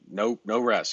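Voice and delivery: normal talking voice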